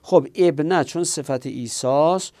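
ordinary delivery

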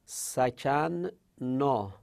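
سکن نا said very slowly, sakan and na split apart with the doubled n held; teacher sounding out سکن نا slowly; syllable by syllable. Shown as a demonstration. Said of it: "ساکن شدیم ما".

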